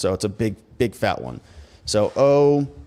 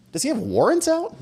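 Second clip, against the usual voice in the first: sudden high, startled wondering; incredulous question. Retold or imitated. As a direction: ask it as if it just hit you. Wondering aloud about the warrants.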